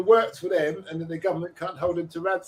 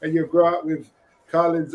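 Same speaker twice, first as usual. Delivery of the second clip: normal talking voice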